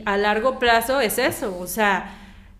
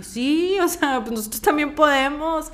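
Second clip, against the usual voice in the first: higher-pitched mock protest; retold squabble; 5 semitones higher than usual. Actress recalling arguments over cultures, imitated protest. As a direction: imitate someone arguing back, pitch lifted. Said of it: "se peleaban así de que".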